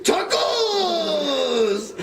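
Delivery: very high, shrill-free squeaky blob cry; blob creature voice. Acted. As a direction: shriek it like a silly little monster. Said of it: "And they have voices".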